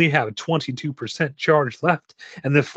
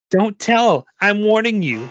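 put-on high, forceful child's warning; raised girlish voice, insistent; warning little girl; 9 semitones higher, 5 dB louder than usual. A narrator's reading. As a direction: threaten like a small kid. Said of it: "with a grimace on her face".

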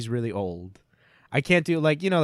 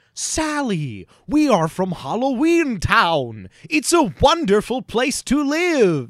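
higher, louder, slow over-enunciated; Jack Skellington; 9 semitones higher, 8 dB louder, much slower than usual. Retold or imitated. Mocking Jack Skellington talking to Sally, slow and over-enunciated. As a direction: declaim every word too clearly. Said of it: "That's how he talks".

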